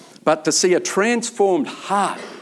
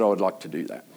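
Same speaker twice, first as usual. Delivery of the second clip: quiet sincere acceptance; 10 semitones lower, 7 dB quieter, faster than usual. Retold or imitated. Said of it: "And he said".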